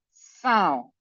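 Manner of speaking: "são" as a nasal ow, falling. Shown as a demonstration. Teacher repeating the model, nasal "são". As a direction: send "são" out through the nose.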